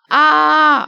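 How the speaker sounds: high, loud "ah" scream; acted scream. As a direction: yell out as if flung through the air. Timmy flung from a catapult.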